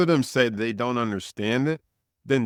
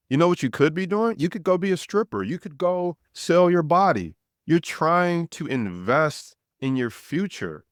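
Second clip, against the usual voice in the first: higher, fast, indignant rant; riled up; 7 semitones higher than usual. Real, not acted. Venting at people who call investing a scam, indignant. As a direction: vent the irritation, pitched up. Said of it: "I get a little bit pissed off".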